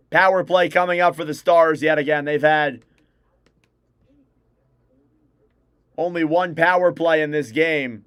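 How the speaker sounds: brisk broadcaster's call of the action; live play-by-play. Acted. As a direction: call the hockey game live for stream viewers.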